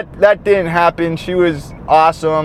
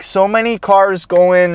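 normal talking voice

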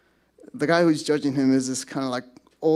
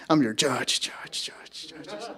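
fading echo on judge; dramatic echoing reverb; echo sound effect; 7 dB quieter, slower than usual. Retold or imitated. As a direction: let judge echo away into a whisper. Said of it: "there's sound effects".

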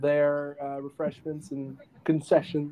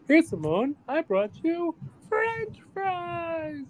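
much higher, slow squeaky Pee-wee; slower than usual. Retold or imitated. Pee-wee offering Simone fries, squeaky high voice. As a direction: sing it out sweetly like Pee-wee.

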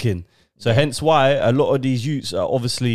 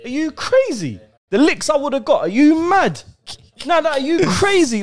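incredulous outrage; 13 semitones higher, 3 dB louder than usual. Retold or imitated. Imagined mum's furious reaction.

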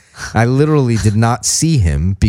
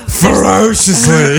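deeper and gayer; 5 semitones higher, slower than usual. Pretend. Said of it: "Deeper and gayer".

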